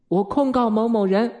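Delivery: high, level, sing-out recital; lightly voiced child making a complaint; child's formal complaint. A narrator's reading. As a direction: announce it like a polite pupil reporting. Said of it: "礼貌地举起右手说".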